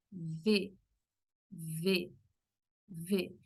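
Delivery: V held with teeth on lip, buzzing; Portuguese v. Shown as a demonstration. Teacher demonstrating the Portuguese v sound. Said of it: "en portugués".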